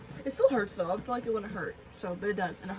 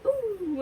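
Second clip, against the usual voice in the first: mock wince; 10 semitones higher, 4 dB louder than usual. Imitating herself as needle goes in.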